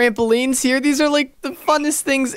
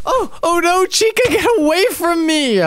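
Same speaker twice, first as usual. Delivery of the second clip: higher, startled cry to get away; mock panic; 7 semitones higher than usual. Child shrieking at a big scary animatronic to go away. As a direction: shoo off a scary creature in mock fright.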